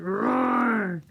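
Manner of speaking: big loud roar; lion roar. Pretend. Teacher roaring for the kids in the song. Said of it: "comes in like a lion".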